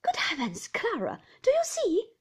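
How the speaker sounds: horrified exclamation, leaping high and loud at the end; exclaim, building to a high cry; rising alarm. A narrator's reading. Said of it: "exclaimed Miss Murdstone".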